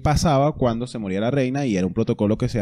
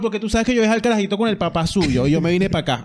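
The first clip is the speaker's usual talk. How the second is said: higher, lightly voiced [name] answering a fan; a playful take on [name] chatting; mock [name]; 8 semitones higher than usual. Pretending to be [name] for a mock interview, higher playful voice. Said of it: "yo soy [name]".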